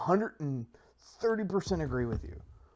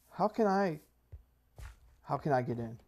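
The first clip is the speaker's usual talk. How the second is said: normal talking voice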